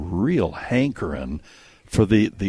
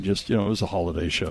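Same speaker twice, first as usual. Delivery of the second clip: ordinary talk, nothing marked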